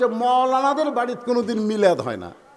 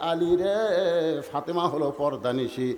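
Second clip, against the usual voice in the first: melodic divine proclamation; 5 semitones lower, 3 dB louder than usual. Acted. God answers Ali about Fatema's grave, low melodic chant. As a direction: intone it solemnly, swelling toward the end.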